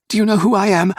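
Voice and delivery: a little earl's son asserting his rank; indignant child. A narrator's reading. Boy demanding from his captors.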